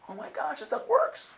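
high, excited mock amazement; amazed. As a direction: gasp it in delighted wonder. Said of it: "And I'm like".

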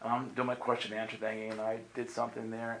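ordinary talk, nothing marked

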